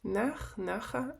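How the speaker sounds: "наха" with the first "на" stressed, as in colloquial speech; stress on first syllable. Shown as a demonstration. Teacher models speech heard in Israel, "наха" stressed on the first syllable. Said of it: "по принципу розмовної мови".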